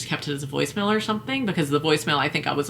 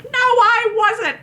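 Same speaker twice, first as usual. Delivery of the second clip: much higher, louder, slow drawn-out denial; mock whiny denial; 5 dB louder, much slower than usual. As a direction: stretch the protest out shrilly. Imitating volunteer's denial.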